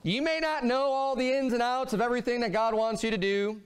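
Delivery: normal talking voice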